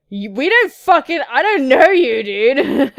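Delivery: loud, high protest; mock outrage. Real, not acted. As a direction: object loudly and indignantly. Objecting to being called sweetheart.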